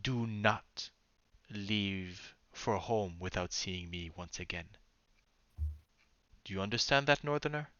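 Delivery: soft, measured murmur, still voiced; hushed in-character warning, not fully whispered; mock whisper in the ear. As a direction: speak low and close, pausing between phrases. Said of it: "whispering in your ear and says".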